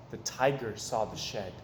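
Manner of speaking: plain reading voice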